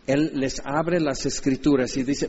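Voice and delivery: normal talking voice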